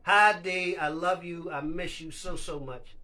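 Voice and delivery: ordinary delivery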